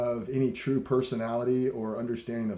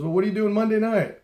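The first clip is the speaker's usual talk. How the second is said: higher, louder, quick retold question; retold eager invitation; 8 semitones higher, 5 dB louder, faster than usual. Guitar idol invites a fan out, upbeat and quick. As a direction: toss out the invite briskly, imitating him.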